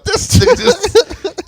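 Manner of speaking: high, slow, laughing words; laughing disbelief. Retold or imitated. About the grandfather, in a slow high laughing voice. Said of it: "I was like".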